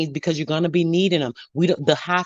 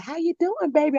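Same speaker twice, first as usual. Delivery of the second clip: put-on sweet old lady; 10 semitones higher than usual. Old lady greeting a shopper at the store, high sweet voice. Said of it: "She was a sweet little old lady too".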